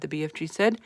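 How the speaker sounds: plain reading voice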